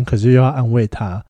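ordinary talk, nothing marked

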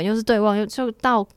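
ordinary talk, nothing marked

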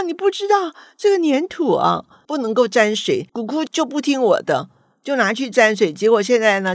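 high, loud tearful child protest, imitated; grandma imitating a wailing child upset over ruined clay; granddaughter wailing. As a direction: retell the child's bawling complaint, pitch way up and loud. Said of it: "声嘶力竭的".

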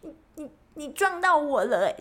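high, sputtering angry stammer; too flustered to finish the scolding; stammering in anger. Retold or imitated. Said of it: "我气到讲话".

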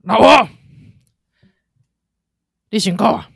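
loud hearty husband, then laugh; lightly voiced jolly husband. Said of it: "哈哈大笑可以".

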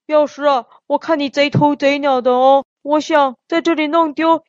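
normal talking voice

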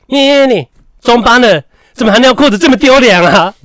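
very high, loud mocking shout; loud shaming yell. Teacher loudly shaming the boy on the school bus, very high yell. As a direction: shame the kid in front of everyone. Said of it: "他就很大声念我的名字".

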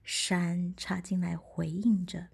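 usual speaking voice

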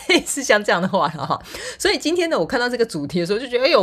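ordinary delivery

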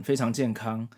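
ordinary talk

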